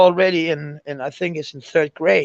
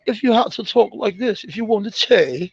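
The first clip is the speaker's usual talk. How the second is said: higher-pitched imitation English accent; 5 semitones higher than usual. Shown as a demonstration. Demonstrating the required school accent, English accent. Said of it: "speak with an English accent".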